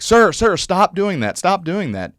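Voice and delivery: high, loud alarmed retold shout; urgent shout. Workers stopping a man ripping his ballot, high urgent shout. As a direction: rush in and stop him, mock panic.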